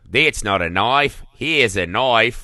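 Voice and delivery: put-on slow, deliberate Crocodile Dundee Australian accent. Host asked for an Australian accent, slow Australian impression. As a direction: drawl it out like an Australian bushman. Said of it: "Are you gonna attempt an Australian accent?".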